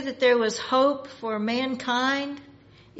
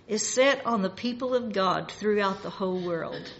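ordinary delivery

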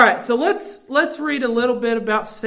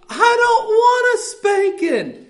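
put-on higher, slow child's whimper; crying child; 8 semitones higher, 4 dB louder, slower than usual. Preacher imitating his crying child, high whimper. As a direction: whimper it like a small scared kid. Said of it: "they'd start crying and say".

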